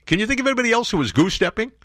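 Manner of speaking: pointed jab. Real, not acted. Pointed rhetorical question.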